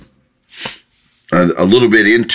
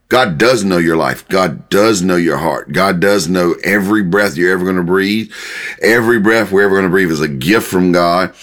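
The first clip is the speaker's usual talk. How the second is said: ordinary talk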